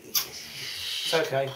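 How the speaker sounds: slow whispered reassurance; soothing dog. Real, not acted. Calming the dog beside her, slow soft whisper. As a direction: hush the dog gently, very slow.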